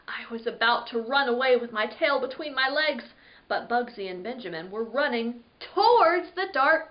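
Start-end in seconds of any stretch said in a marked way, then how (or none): none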